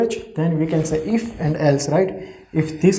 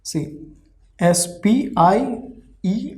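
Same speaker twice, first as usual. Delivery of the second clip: ordinary talk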